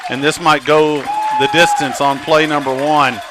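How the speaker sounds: loud, lively touchdown call; live play-by-play. Real, not acted. Announcer calls a first-play touchdown to listeners, excited play-by-play. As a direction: call the score with punchy, projected energy.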